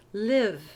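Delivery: "Live" short i, no D at the end; clipped "Live" with a short i; model word. Shown as a demonstration. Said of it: "Notice I just said live".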